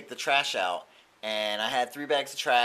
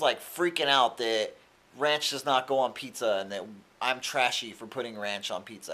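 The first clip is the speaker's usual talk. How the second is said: ordinary talk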